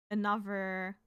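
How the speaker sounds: ordinary talk, nothing marked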